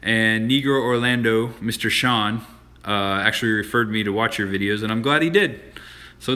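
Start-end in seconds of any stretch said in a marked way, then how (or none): none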